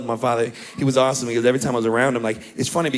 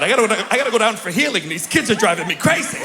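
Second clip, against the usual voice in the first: shaking dad; 6 semitones higher than usual. Retold or imitated. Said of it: "He used to go to church like that".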